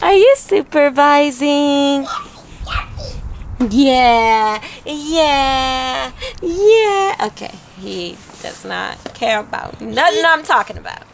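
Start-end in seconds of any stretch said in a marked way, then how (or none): 0.0-7.1 put-on playful baby talk. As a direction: tease the baby to make him laugh. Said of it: "I'm trying to make him laugh".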